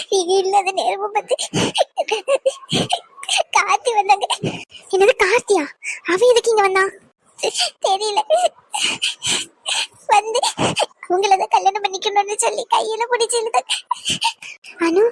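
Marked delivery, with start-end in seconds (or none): 0.1-4.3 crying. Acted. Anu tells sister-in-law while crying. 7.9-13.7 crying. Acted. Anu tearfully tells what happened.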